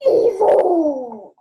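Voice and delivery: loud, high outraged cry of evil; a loud indignant exclamation; evil exclaimed. Real, not acted. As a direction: call him out, loudly. Child exclaims at Grinch.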